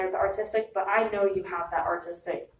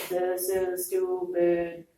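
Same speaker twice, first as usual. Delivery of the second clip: slow, fed-up grumble; a sulky, dismissive mutter; grumpy complaint; much slower than usual. Retold or imitated. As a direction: drag it out like a bored student. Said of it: "You were like".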